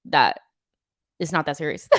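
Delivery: usual speaking voice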